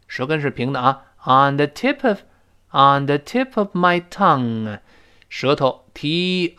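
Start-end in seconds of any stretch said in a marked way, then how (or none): none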